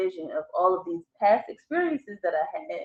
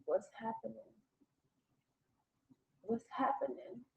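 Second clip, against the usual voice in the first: frightened, hushed and much softer; scared hush; 3 semitones higher, 13 dB quieter than usual. Retold or imitated. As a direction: barely breathe the words out in fear. Frozen in bed, terrified.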